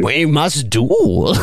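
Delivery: slow, drawn-out pompous declaration; mock-solemn challenge. Honor guard demanding a duel mid-invasion, mock-solemn.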